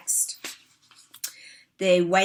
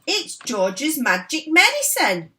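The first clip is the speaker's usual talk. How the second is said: higher, louder boastful shout; shouting grandma; 8 semitones higher, 7 dB louder than usual. A narrator's reading. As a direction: crow it out proudly. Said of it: "Grandma shouted".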